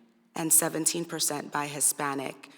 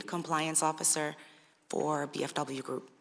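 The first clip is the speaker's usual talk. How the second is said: ordinary talk, nothing marked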